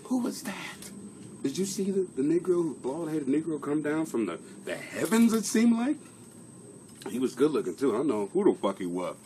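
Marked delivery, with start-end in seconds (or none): 0.0-6.0 high-pitched puzzled voice after the outburst; mock bewilderment. Streamer asking who came down from the heavens, mock bewilderment. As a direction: play the stunned witness, pitched up high. Plays baffled witness to own outburst.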